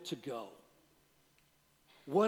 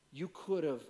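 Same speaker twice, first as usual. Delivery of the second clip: ordinary talk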